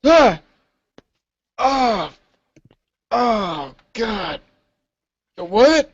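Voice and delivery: high yelps sinking into groans; startled awake. Acted. Sleeping guard woken by a yell, startled. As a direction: play being jolted out of sleep.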